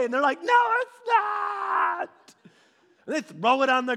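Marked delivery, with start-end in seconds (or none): 0.4-2.1 high, loud, slow drawn-out protest; toddler tantrum. Retold or imitated. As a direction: wail it like a furious little kid. Kid protesting in meltdown.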